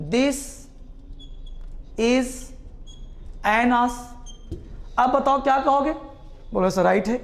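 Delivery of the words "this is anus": This, is, anus said word by word with pauses; spaced out slowly. Shown as a demonstration. Teacher spelling out the sentence word by word for class. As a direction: separate each word clearly. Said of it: "फिर से लिखा हमने".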